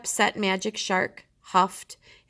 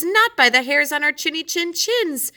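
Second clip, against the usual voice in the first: pigs' defiant answer; 10 semitones higher, 5 dB louder than usual. Acted. Pigs answer the shark.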